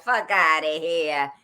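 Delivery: slow, drawn-out Brooklyn dismissal; Brooklyn accent. Retold or imitated. Imitating her Brooklyn ex-husband's brush-off, in a Brooklyn accent. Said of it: "My ex-husband's from Brooklyn".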